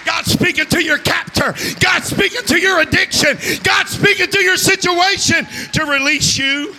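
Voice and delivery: preaching at full force. Acted. Preacher warning runaways from their calling, full-force preaching. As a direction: drive it home to the congregation, climbing. Altar-call climax of sermon.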